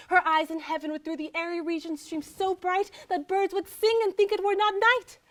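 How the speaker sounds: rapturous praise. Acted. Lover praising the beloved's eyes, carried away with rapture. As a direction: let adoration carry you upward in one sweep.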